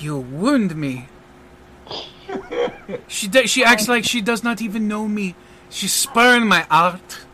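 hammed-up wounded dignity, French accent; mock-wounded French magician. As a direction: lament like a spurned French artiste. French magician feigns heartbreak.

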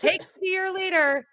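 high, odd alien voice, playful; mock alien voice. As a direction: say it like a cartoon alien landing. Quoting classic alien movie line.

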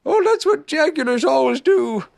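high-pitched bear explaining; knowing Pooh Bear. A narrator's reading. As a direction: explain it simply in a high character voice. Narrator voices interested Pooh.

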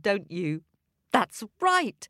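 ordinary delivery, nothing marked

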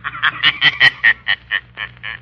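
mocking laugh. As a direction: laugh as if you already know everything. The Shadow laughs on the phone.

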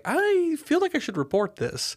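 high, put-on worried voice; mock concerned voice. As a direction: play the shocked citizen, high and thin. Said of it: "I was like".

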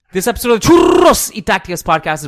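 loud, high ad-read announcer. Acted. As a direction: boom it out like a radio ad. Sponsor read announcer style.

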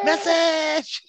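very high drawn-out catchphrase; baby character's call. Retold or imitated. Baby popping out with the catchphrase, high mock cry. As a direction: echo the baby gag in a high put-on voice. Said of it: "when he popped out as the baby".

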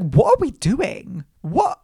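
high, drawn-out appalled question; retold squirming protest; uncomfortable disbelief. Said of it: "so uncomfortable with".

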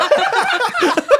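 high giggling burst; burst of high laughter. Real, not acted. Laughing at own dodge.

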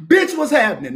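high, loud shouted catchphrase; slogan shout. Shown as a demonstration. Host shouting his new slogan to viewers, loud and high. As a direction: yell the catchphrase out big. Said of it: "That's my new thing".

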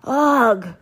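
loud, high "ugh"; disgusted cry. A narrator's reading. Duchess after biting the roll, disgusted cry. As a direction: recoil loudly from a bad bite. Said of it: "cried the Duchess".

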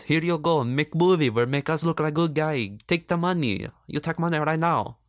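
hammed-up pushy money offer; mock China bribing. China buying a flattering movie, pushy broken-English bribe. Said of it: "Pop China whipped out its wallet and goes".

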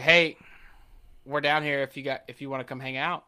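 usual speaking voice

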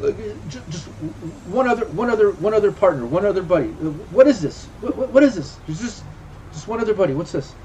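cornered man pleading in a high voice; flustered, stammering plea. Acted. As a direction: plead nervously, repeating yourself as you stumble. Game master voices cornered stammering character.

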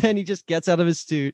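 ordinary talk

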